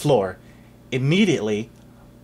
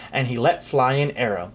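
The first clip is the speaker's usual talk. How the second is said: usual speaking voice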